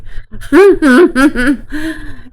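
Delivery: high laugh that breaks toward crying. Real, not acted. Vlogger laughs through near-tears about the murder case. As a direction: let the laugh crack into a sob.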